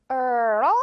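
put-on girl with the tongue lifting to L; shovel-tongue girl. Shown as a demonstration. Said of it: "삽 같은 이 혀 발음". (혀를 삽처럼 girl)

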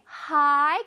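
"하이" with the vowel drawn out; very long HIGH. Shown as a demonstration. Teacher showing pupils the long first part, drawn out. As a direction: stretch "하이" out long. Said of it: "아주 길게".

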